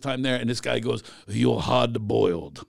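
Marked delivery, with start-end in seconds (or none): 1.3-2.6 put-on slow, slightly low Japanese-accented English; drawn-out mock Japanese accent. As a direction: imitate a Japanese man's halting English. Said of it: "It's actually what he sounded like".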